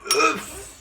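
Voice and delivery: high loud pained grunt. Real, not acted. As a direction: wince out a sharp ugh. Hair dye stings.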